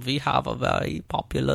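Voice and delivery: hammed-up German movie-villain accent; caricatured Nazi-style German accent; exaggerated German accent. Shown as a demonstration. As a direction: push the mock German accent harder. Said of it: "accent sounds more Nazi if you".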